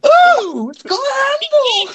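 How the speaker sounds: very high, slow shrieking mock; mock Miyamoto shriek; imitating Miyamoto. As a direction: screech it out in delight. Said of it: "Is that really what he said".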